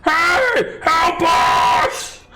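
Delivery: high, loud, panicked giant cry; wounded giant screaming to his guards for help; giant yelling for help. Acted. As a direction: shriek for help like a scared giant. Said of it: "Yelling to the guards".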